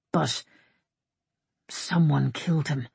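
halting, with a pause after "But"; understated hesitant objection. A narrator's reading. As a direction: venture it cautiously, unsure of speaking. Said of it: "Avon said hesitantly".

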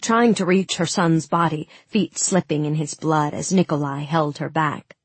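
usual speaking voice

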